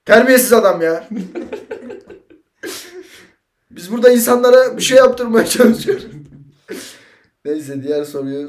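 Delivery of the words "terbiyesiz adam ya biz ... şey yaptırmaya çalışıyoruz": loud, high indignant outburst; mock outrage. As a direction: blow up in playful outrage. Host outraged at cohost's comment. (sahte öfkeyle bağırarak)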